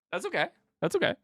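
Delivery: high, soft, teasing reassurance; high mock-forgiving. Real, not acted. Streamer teasing a viewer who peeked early. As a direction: forgive them with a playful sneer.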